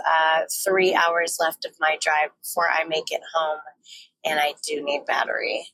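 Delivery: ordinary talk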